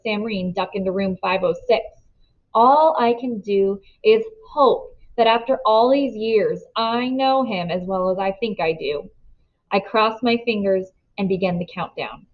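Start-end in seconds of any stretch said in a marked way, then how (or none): none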